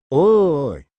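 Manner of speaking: loud call of Oi. Acted. Hajime calls out to disappointed Yu and Rimuru, loud call. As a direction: call out sharply to get attention.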